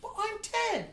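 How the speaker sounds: put-on high-pitched kid voice; imitated child. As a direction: protest like a baffled ten-year-old.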